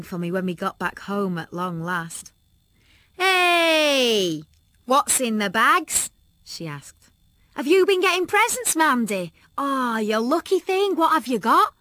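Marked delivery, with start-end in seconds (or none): 3.2-11.7 high, loud eager chatter; excited friend Tanya. A narrator's reading. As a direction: pounce on the shopping bags.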